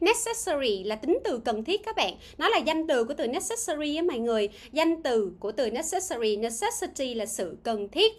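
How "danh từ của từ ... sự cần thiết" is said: ordinary talk, nothing marked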